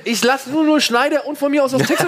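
defending his film list, pleading high. Real, not acted. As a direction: plead your case up high. Pleading in argument.

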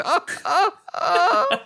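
high laugh breaking into crying; strained laugh-cry; laugh turning to sob. Retold or imitated. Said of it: "auf fröhliche Weise".

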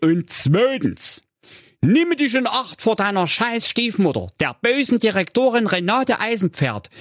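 hohe, schnelle Zwergenstimme; Zwerge. A narrator's reading. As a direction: aufgekratzt warnen wie ein Zwerg. (hohe Zwergenstimme)

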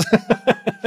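bright high giggle; high burst of laughter. Real, not acted. Laughing at own mix-up. (hohes Auflachen)

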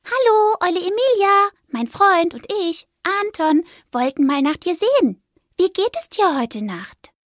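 high squeaky friendly greeting; cheerful little mouse. Acted. Mouse greeting the owl, high and squeaky. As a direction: call up brightly to the owl.

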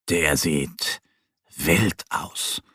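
low-pitched, proud little bear. A narrator's reading. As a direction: say it low with quiet pride. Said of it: "sagt Mo stolz".

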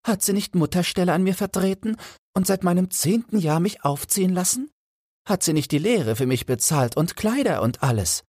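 high pitched Felix vowing he'd rather die; indignant young goldsmith. Acted. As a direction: refuse fiercely, close to tears.

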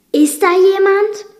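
ordinary delivery

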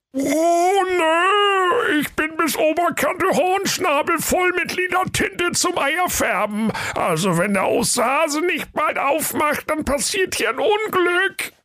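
very high whining octopus voice; a bursting octopus squeals in panic; nervous octopus. Acted. Octopus full of ink whines in a very high voice. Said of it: "Tintenfisch sprach".

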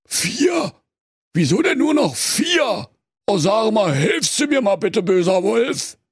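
high, baffled bear voice; puzzled bear. A narrator's reading. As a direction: bear confused.

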